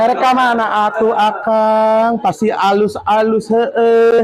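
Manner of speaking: a playful hint of a West Java accent, drawn out. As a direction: gaya Sunda Jawa Barat yang halus. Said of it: "Jawa Barat kayak gitu ya".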